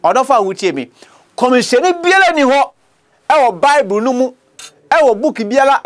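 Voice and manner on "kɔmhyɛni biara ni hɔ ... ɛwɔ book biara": higher, rising preacher's challenge; preaching climb. Acted. Preacher challenging listeners about every prophet. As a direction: push the challenge up high.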